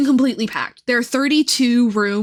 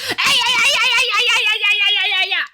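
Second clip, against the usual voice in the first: frantic ay ay ay; 9 semitones higher than usual. Retold or imitated. Trainer nurse scolding a trainee, high frantic yelps. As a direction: yelp it like a flustered trainer. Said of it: "she goes".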